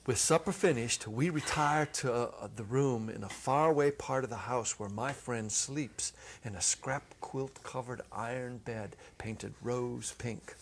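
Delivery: usual speaking voice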